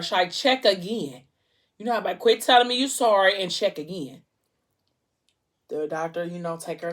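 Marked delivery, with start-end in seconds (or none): 0.3-1.2 louder, insistent plea to recheck; retold demand, pressed out firmly. Mother demanding the doctor recheck. 2.2-4.2 loud, insistent retort; retold outburst, louder and pressing; upset demand. Mother rejects doctor's apology.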